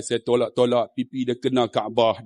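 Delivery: ordinary talk, nothing marked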